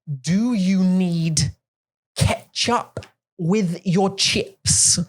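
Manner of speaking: very slow, over-articulated server; drawn-out talking-down voice; mock slow waiter voice. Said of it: "Why are you talking like that?".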